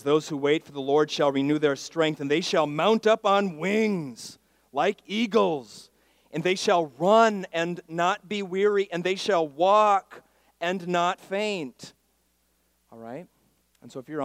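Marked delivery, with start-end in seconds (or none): 0.0-11.9 loud scripture proclamation, building higher; full-force preaching. Real, not acted. Preacher proclaims Isaiah's promise to church, full-force. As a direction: declare the verse with rising force, stretching the key phrases.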